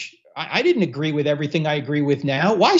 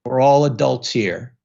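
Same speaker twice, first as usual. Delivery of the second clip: a light mock-deadpan spoof hero, lower and slow; Leslie Nielsen deadpan; 7 semitones lower, slower than usual. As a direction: lightly play the straight-faced movie hero scolding someone, low and slow. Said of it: "that's Leslie Nielsen".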